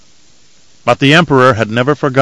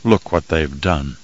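softer, lower, slow and mournful; morose murmur; 6 semitones lower, 6 dB quieter, slower than usual. A narrator's reading. Grieving man looking over his wounded city, morose murmur. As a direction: let it sink into a hushed lament. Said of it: "he whispered in a morose voice".

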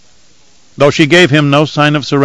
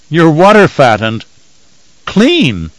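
teasing scorn, higher on clean; deprecating sniff; 6 semitones higher, 4 dB louder, slower than usual. A narrator's reading. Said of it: "he sniffed deprecatingly".

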